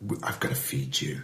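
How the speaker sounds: whispered aside, very soft; hushed concern. Acted. Everyone looking at the single guy, whispered concern. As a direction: whisper it as a private resolve.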